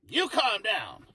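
high, loud play-acted comeback; mock angry retort. Co-host plays customer turning on manager, mock angry. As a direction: throw it back as the riled-up customer.

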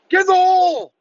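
high, loud mock yell of a golf spectator; mocking golf fan yell. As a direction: ham up a fat golf fan bellowing at the tee. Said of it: "dudes yelling".

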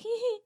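put-on high, giggly little ghost-child laugh; child giggle. Telling friends about a ghost, she mimics a child's giggle. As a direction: imitate a creepy child's giggle. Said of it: "kinda like".

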